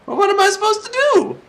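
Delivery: a helpless high-pitched whine at being stuck; lost and whining in a squeaky put-on voice; whiny falsetto. Lost, complaining to himself.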